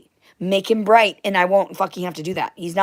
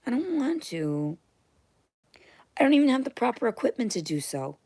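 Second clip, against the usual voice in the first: whispered excuse; 7 semitones higher than usual. Real, not acted. Whining excuse.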